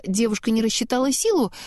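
plain reading voice

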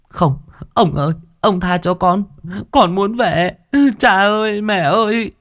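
put-on high, crying child's plea; terrified pleading. A narrator's reading. The boy begging Tôn Thái to let him go home, high and terrified. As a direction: beg for your life, calling for your parents.

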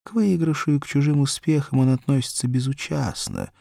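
usual speaking voice

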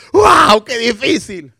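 high and loud, overwhelmed; a hammed-up wail of difficulty, high and loud; mock exasperation. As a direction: exclaim it as if it's all too much. Mocking the carnal outlook.

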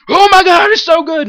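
excited fangirl scream; screaming with joy. Retold or imitated. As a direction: shriek like a thrilled fan. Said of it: "screaming and laughing".

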